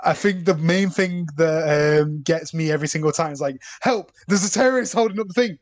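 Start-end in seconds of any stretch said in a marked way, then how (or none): 3.8-5.6 lightly voiced panicked Alan Partridge, pitched higher; hammed-up shout for help, higher; quoting a film scene, a mild mock panic; mock panicked cry. Retelling a favourite Alan Partridge scene.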